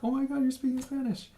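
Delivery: high, gushing imitation of an excited mom; giddy mom. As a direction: squeal it like a thrilled mother. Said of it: "she gets all, like, giddy".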